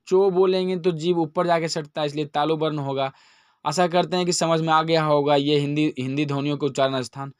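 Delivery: ordinary talk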